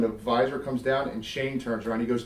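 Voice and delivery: ordinary talk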